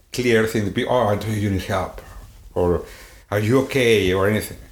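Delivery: ordinary talk, nothing marked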